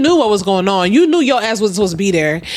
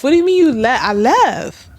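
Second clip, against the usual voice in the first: usual speaking voice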